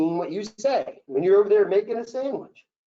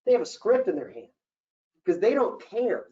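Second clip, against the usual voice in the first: usual speaking voice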